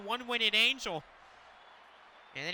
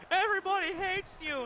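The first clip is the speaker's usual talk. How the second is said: much higher, a faint tiny voice; squeaky distant imitation of a fan; faint little voice; 10 semitones higher than usual. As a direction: mimic a thin little voice heard far off in headphones. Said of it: "in this, like, faint little voice".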